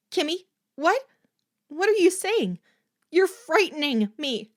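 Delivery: high, loud panicked pleading; terrified girl begs her friend, loud and high; frightened shouting. Acted. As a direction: scream your fear at her. Corky terrified by possessed friend.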